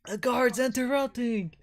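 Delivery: high, strained call from afar; distant yell. Acted. Kaz calling out from the distance, high yell. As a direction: yell it high, as if across a crowd. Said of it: "Kaz in the distance".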